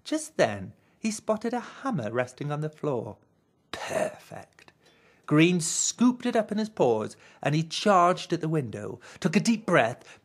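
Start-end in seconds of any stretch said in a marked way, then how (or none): 3.7-4.7 hushed whispered discovery; a soft delighted whisper. A narrator's reading. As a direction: breathe it like a secret find. Green finds hammer, whispers.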